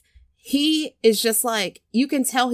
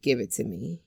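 sultry invitation; 8 semitones lower, 8 dB quieter than usual. Retold or imitated. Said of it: "and she's like".

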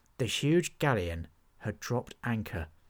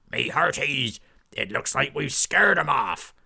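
loud gruff pirate bellow; rally the crew like a swaggering buccaneer; pirate captain; 4 semitones higher, 7 dB louder than usual. Acted. Pirate captain ordering his crew ashore, loud.

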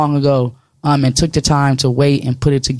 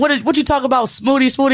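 mock-baffled uncle, pitched higher; confused uncle; 8 semitones higher than usual. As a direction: play the puzzled uncle who can't get the name. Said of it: "His uncle's like".

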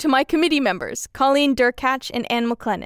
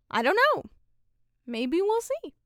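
high playful; 8 semitones higher, 5 dB quieter than usual. Real, not acted. Playful uncertain shrug.

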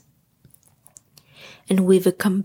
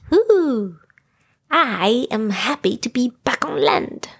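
Tigger exhaling relief. A narrator's reading. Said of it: "exhaled Tigger".

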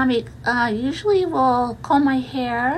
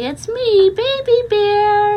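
higher, louder, slow little bear voice; loud squeaky baby bear; 6 semitones higher, 5 dB louder, slower than usual. Retold or imitated. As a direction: call out as a tiny bear. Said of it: "and I'll say".

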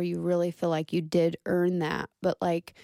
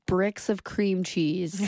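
usual speaking voice

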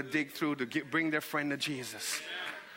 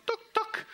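two high, short "tuk" sounds; imitated mud taps. Mud falls on the parchment, high imitated taps. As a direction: tap out falling bits of mud.

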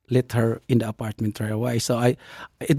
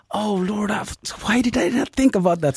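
higher, self-mocking "oh Lord" at his own oversight; rueful exclamation; 8 semitones higher than usual. Telling listeners how he scolded himself, in rueful surprise. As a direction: kick yourself in disbelief.

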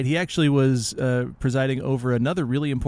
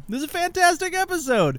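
gushing; 14 semitones higher than usual. Real, not acted. Gushing praise.

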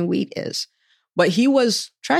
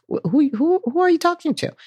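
high-pitched, stammering disbelief; incredulous; 11 semitones higher than usual. Acted. Doubter answers God's big calling, high incredulous stammer. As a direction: stumble on the who, voice shooting up. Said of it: "And you're like".

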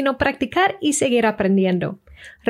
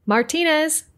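high-pitched call for a patient; nurse calling out; 6 semitones higher than usual. Retold or imitated. Nurse calls out in the waiting room, calling out. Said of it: "the nurse called out".

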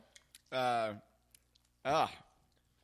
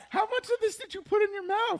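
incredulous shout. Real, not acted. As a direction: shout it in total disbelief. Friend ate whole wax candy.

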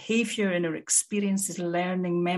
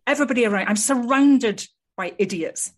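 higher, louder mock exasperation; exasperated complaint; 5 semitones higher, 6 dB louder than usual. Narcissistic client complaining to a therapist, exasperated. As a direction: complain loudly as an imagined self-important client.

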